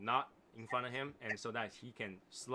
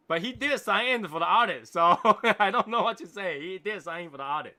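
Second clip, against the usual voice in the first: raised, higher voice with a laugh midway; loud amused insistence; 7 semitones higher, 12 dB louder than usual. Real, not acted. Host joking about the missing man's audit sign-in, loud and amused. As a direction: insist loudly through amusement.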